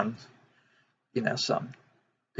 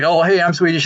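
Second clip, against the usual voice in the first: higher, louder imitation of an introduction; eager mock greeting; 6 semitones higher, 6 dB louder than usual. American greeting someone from Sweden, eager mock greeting. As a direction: mimic an over-keen self-introduction.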